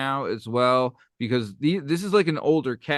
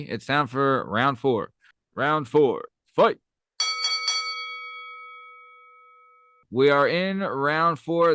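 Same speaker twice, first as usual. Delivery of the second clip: fighting game announcer; 3 semitones higher, slower than usual. Acted. Announcing the championship round.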